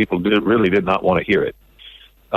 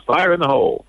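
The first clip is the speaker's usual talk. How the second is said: put-on a lighter echo of the boy's yell; mild kid imitation; 7 semitones higher than usual. Host closing story with mild echo of boy's cry. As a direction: toss off the catchphrase fondly. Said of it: "good job, Mason".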